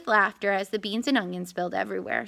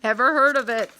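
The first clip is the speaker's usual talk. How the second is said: dog voice; 5 dB louder, faster than usual. A narrator's reading. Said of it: "said the dog".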